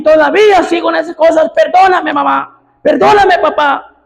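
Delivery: voz alta y aguda, clamando; predicación a todo pulmón. Acted. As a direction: suplica a gritos desde el púlpito. Preaching again at full force. (predicador clamando perdón a mamá y papá)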